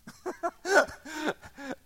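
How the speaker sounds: high-pitched amused laugh. Real, not acted. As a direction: burst out laughing. Laughs at own blunt remark.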